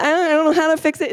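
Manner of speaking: quick, high-pitched tearful protest; mock crying. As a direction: imitate a helpless crier, words tumbling out. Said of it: "they start crying".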